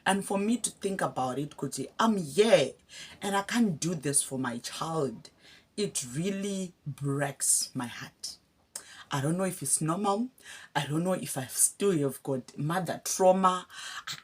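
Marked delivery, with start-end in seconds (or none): 5.8-8.4 slow, breathy whispered sorrow; real hurt, barely voiced; heartbroken whisper. Real, not acted. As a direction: let it break down into a whisper. Emotional about daughter.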